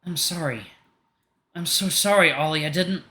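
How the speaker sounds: the fight drained, stunned remorse; horrified apology. Acted.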